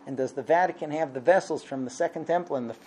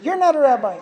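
high, loud mock outrage; raised-voice imitation of angry critics; indignant retort; 9 semitones higher, 7 dB louder than usual. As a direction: throw it back as an offended accuser. Offended crowd throws it back.

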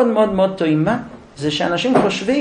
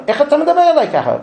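offended wife; 9 semitones higher, 3 dB louder, faster than usual. Acted.

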